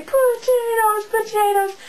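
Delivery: high, slow, drawn-out screams; screaming. Retold or imitated. Hyper kid screaming the word out of class. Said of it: "swinging on my locker, going".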